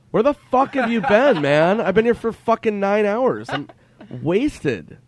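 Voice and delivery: retold angry yell, high and loud; exasperated outburst. Friend confronting comedian nine hours late, retold exasperation. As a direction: let the mock outrage rip.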